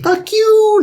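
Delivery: high loud mock taunt; taunting shout. Cecilia dancing off camera, taunting shout. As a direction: yell it gleefully at him. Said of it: "She's like".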